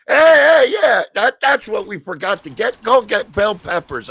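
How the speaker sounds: high, excited call to the group; loud teasing. Retold or imitated. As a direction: call it out high, egging everyone on. Retelling his teasing shout to others.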